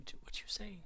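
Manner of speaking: soft unvoiced aside; whispering. Real, not acted. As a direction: whisper it to yourself.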